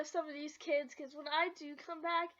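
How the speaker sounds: ordinary talk, nothing marked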